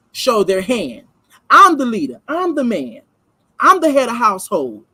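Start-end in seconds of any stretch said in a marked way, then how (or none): 1.5-4.8 I'm hammed up, punchier and louder; mock boastful husband laying down the law; louder mocking macho man. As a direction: stomp your foot down as the man of the house. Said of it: "As in them putting their foot down".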